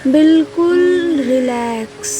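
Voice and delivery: slow, measured meditation guide voice; unhurried yoga relaxation instructions, words gently drawn out; guided relaxation. Acted.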